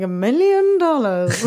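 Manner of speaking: hammed-up evil mastermind; evil genius. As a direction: draw it out like a villain's ransom demand. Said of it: "like, evil genius".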